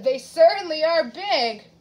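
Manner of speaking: usual speaking voice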